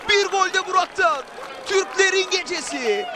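normal talking voice

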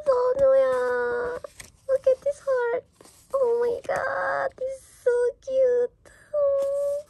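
high, loud cooing squeal; delighted squeal. Real, not acted. Fan seeing an idol's heart drawing, delighted squeal. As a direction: squeal over something unbearably cute.

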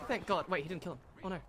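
ordinary talk, nothing marked

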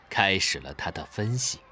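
usual speaking voice

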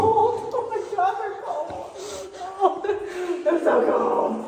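high, slow laughing groan; laughing in pain. Real, not acted. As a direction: laugh through the cold. Feet freezing, laughing.

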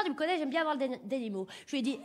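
usual speaking voice

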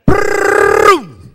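big burst of real laughter, high and loud. Real, not acted. Preacher laughing at his brother hitting like Popeye, loud laugh.

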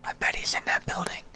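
hushed stealthy whisper. Real, not acted. Player spotting an enemy for his teammate, whispering. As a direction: keep it under your breath so nobody hears. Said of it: "But why are we whispering?".

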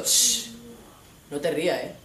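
ordinary delivery

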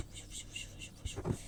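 soft hissing fış of a spray can; whispered spray noise. Pretend. Child spraying the blue thing, whispered hiss sounds.

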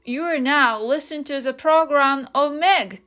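slow, clear repeat of "You're now listen to the program of Meg"; slow demo repeat; slow model repeat. Shown as a demonstration. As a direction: say it again slowly for learners. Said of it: "正解は".

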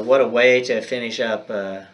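ordinary delivery

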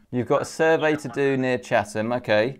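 normal talking voice

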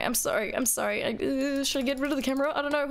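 usual speaking voice

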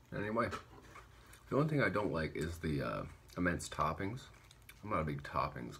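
between bites of food; slight food in mouth; talking while eating. Real, not acted. Seen 0: Holds burger throughout, picks topping to mouth near 3.7s.

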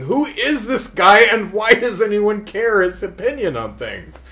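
high, loud, baffled question; exasperated asking. Retold or imitated. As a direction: ask it fed up and baffled.

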